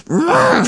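high, fed-up "ugh"; high exasperated groan; frustrated groan. Retold or imitated. Stewing while driving home.

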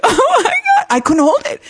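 high, breaking cry of panic; crying meltdown. Retold or imitated. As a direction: let the sob burst out high. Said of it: "I just had a meltdown".

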